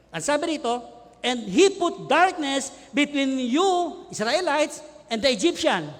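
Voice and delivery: loud, high fervent preaching; full-force preaching. Acted. Pastor preaching to his congregation, loud and fervent. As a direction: proclaim it to the congregation.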